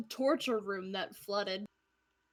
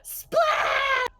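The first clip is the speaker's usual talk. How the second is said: high, loud playful yell; big drawn-out cartoon splash; mock splash shout; 6 dB louder than usual. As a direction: shout it like a cannonball hitting water. Player acts out a cannonball splash.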